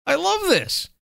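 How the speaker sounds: delighted laugh through the words. Real, not acted. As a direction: burst out laughing with glee.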